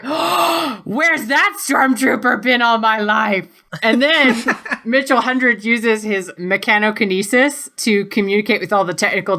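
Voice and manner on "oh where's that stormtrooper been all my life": high, drawn-out mock swoon; swooning admirers. Stormtroopers watch Victor flip his hair, lovestruck swoon. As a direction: gush like a smitten fan, slowly. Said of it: "all the stormtroopers are like".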